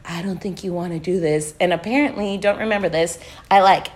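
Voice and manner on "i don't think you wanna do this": soft, calming imitation of a bandmate; gentle caution. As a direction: talk a friend down softly. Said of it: "real gentle".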